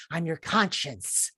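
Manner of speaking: soft, slow, eerie whisper-voice; inner voice. Retold or imitated. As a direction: speak like a ghostly conscience. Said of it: "hearing weird voices".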